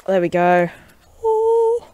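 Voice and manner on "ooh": high, loud, sustained ooh. Real, not acted. Gamer reacting as the box comes open, high ooh.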